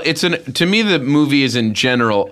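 normal talking voice

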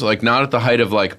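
usual speaking voice

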